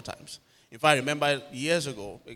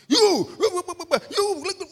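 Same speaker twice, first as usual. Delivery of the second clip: high, loud sputtering tirade; acted-out shrill-pitched accusing rant; mock angry ranting; 9 dB louder, slower than usual. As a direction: imitate a man ranting at you, high and loud. Imitating neighbour's ranting in quarrel.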